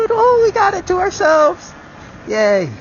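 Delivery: very high-pitched gleeful squeal; excited cheer. Real, not acted. Rider finds they have it to themselves, high excited cheer.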